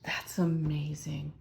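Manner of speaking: slow, breathy hushed whisper; awed whisper. Real, not acted. Reader whispering in awe at a card from her new deck. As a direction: whisper it slowly, struck with awe.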